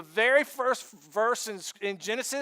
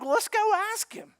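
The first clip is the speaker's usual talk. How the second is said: eager neighbors; 11 semitones higher, 4 dB louder than usual. Retold or imitated. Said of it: "they're like".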